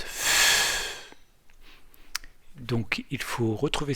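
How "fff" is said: F as a long, unvoiced fff; letter sound F. Shown as a demonstration. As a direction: hold F as pure friction, no vowel. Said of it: "on fait le geste F". (F prononcé « fff », souffle long)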